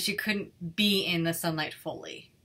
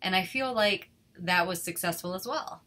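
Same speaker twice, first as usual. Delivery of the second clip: plain reading voice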